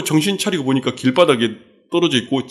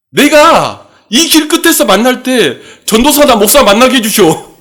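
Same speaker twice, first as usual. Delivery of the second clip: put-on drunken shout at God; 10 semitones higher, 8 dB louder than usual. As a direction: yell up at God like a drunk demanding a sign. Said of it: "하늘 보고 쳐다보고 따지다가 술 취한 채". (하늘에 대고 술 취해 따지듯 높고 크게 외침)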